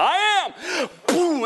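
high, sudden platform proclamation; preaching God's word. Acted. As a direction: burst out high like a pulpit declaration. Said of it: "get on the platform and go".

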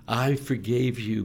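ordinary talk

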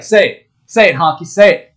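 loud, insistent retold demand; Mike Tyson. Podcaster acting out the boxer pressuring his guest, loud demand.

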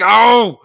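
loud imitated yell of dismay; hammed-up sitcom holler; mock yell. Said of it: "he just yells out".

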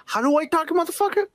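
high-pitched mock impression; high, mocking movie-star impression; imitating the actor. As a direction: push the voice up high to mimic him. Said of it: "fucking says, like".

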